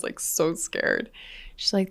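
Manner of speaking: ordinary delivery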